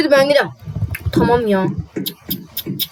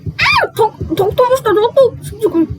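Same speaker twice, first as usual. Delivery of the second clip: struggling stammer; 7 semitones higher, slower than usual. Retold or imitated. Struggling with the mask.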